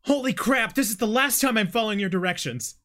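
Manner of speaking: high, loud panicked yell; shocked shout. Acted. Character Jim's line voiced.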